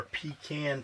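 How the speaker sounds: pecan drawn out as PEE-CAN, rhyming with "can"; mocked pronunciation. Reading out a commenter's pecan pie reply, pecan stretched as PEE-CAN. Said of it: "nobody says pecan".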